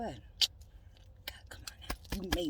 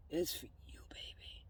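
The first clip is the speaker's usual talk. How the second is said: whisper; 4 semitones lower, 18 dB quieter, slower than usual. Real, not acted. Whispers again to partner.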